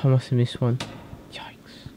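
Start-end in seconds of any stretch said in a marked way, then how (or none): 1.3-1.9 breathy whispered yikes. Real, not acted. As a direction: hush it under your breath. Missed item, startled whisper.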